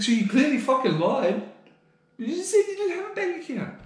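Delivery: high, loud accusing retort; exasperated retort. Retold or imitated. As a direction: catch them out in the lie, indignant. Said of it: "It's like".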